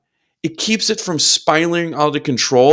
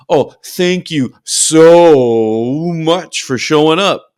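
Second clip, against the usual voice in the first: drawn-out, louder mock gratitude; sarcastic; 5 dB louder, much slower than usual. Client greeting his own subconscious mind, sarcastic. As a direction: stretch the words out with heavy sarcasm. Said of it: "awesome sarcastic voice for his".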